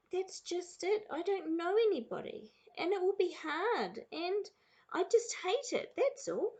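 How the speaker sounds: high-pitched, fretful complaint; child's protest. A narrator's reading. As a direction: plead your case as an upset child. Sarah voiced complaining to father.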